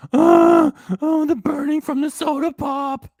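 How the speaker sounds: loud, high hammed-up agony; mocking wail. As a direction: wail like a melodramatic victim, making fun. Mocking streamer's choking complaint.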